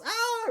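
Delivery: very high mock scream; short high pretend shriek; imitated scream. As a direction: scream like the whole room panicking. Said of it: "Everybody's screaming".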